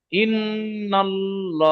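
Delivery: ইন্নাল্লাহ chanted very slowly, with a heavy, round-mouthed ল্লা; heavy l recitation. Shown as a demonstration. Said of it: "ল বলে মুখ গোল হচ্ছে". (ইন্নাল্লাহ সুরে ধীরে, পুর ল গোল মুখে)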